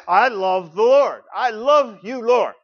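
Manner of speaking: loud, high and tender, slowly at first; heartfelt declaration. Shown as a demonstration. Pastor modelling a warm confession of love, heartfelt. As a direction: pour your heart into it, rising.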